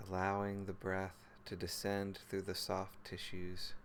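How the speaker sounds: calm, even meditation voice with long pauses; guided meditation. Real, not acted. Teacher leading listeners through body and breath, guided meditation. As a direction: guide them gently into the body, phrase by unhurried phrase.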